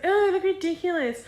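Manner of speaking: high mocking voice of my younger self; mocking whine. Younger self mocking the braces, high whiny scoff. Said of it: "I was like".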